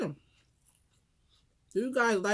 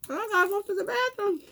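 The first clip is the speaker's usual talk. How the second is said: whiny urgent; 10 semitones higher than usual. Real, not acted. Urgent need for the bathroom.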